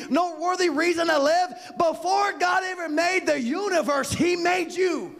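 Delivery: loud, slow, rising preacher's build; preaching at full force. Acted. Preacher telling the congregation they were chosen before creation, preaching hard.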